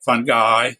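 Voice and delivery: "fungi" said as FUN-guy; hard g as in go, ending like eye in "fungi"; hard-g pronunciation. Shown as a demonstration. Guest listing alternate pronunciations, "fungi" as fun-guy. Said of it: "alternate pronunciations".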